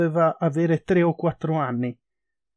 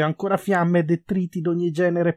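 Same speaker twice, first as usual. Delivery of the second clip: plain reading voice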